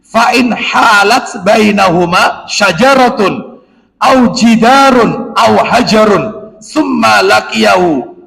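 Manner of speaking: slow chanted Arabic recitation; hadith recitation. Acted. Preacher reciting hadith to the congregation, slow and chanted. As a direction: intone the hadith slowly, reverently.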